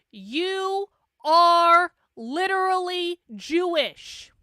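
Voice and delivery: each word stretched slow and loud; emphatic rebuttal. Real, not acted. Indignant rebuttal to the tweet.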